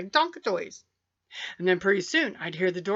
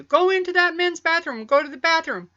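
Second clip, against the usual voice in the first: higher, louder mother's order; bossy mother; 8 semitones higher, 6 dB louder than usual. Retold or imitated. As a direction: send him off like an impatient mom. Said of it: "she'd tell you".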